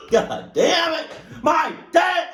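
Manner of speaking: loud high startled yell; shouting. Real, not acted. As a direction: blurt it out in shock. Startled reaction to story.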